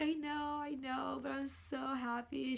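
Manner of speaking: high, small, tearful voice; retold happy crying. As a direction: imitate weepy joy. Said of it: "because I was crying".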